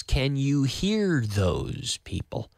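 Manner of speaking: slow, stretched repeat. Shown as a demonstration. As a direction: draw every word out slowly. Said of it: "Y más lento".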